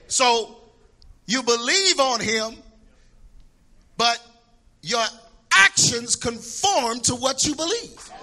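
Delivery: ordinary talk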